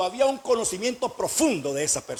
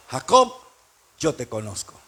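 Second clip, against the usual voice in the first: voice of God; 10 semitones lower than usual. Acted. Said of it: "¿Sabe qué le estaba diciendo?".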